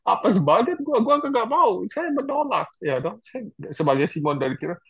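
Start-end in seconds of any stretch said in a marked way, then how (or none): 0.1-2.7 higher, whiny refusal; mock complain about bad luck; put-upon whine, pitched up; grumbling protest. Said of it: "sepanjang jalan ngomel".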